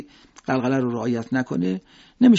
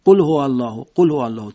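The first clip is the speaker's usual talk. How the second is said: قل هو الله run together, no gap after قل; rushed; 5 dB louder than usual. Shown as a demonstration. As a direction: rattle off the phrase. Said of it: "تند ادا بکنیم".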